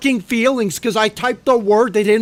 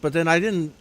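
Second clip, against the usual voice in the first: normal talking voice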